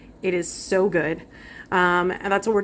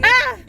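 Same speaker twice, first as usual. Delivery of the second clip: startled yelp; 8 dB louder than usual. Real, not acted. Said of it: "Delayed reaction".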